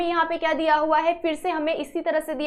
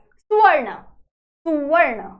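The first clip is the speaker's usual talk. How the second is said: सुवर्ण called out louder, crisp and careful; clear demo; 5 dB louder than usual. Shown as a demonstration. Teacher modelling the word for the class, loud and clear. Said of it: "खुद से बोल के try करो".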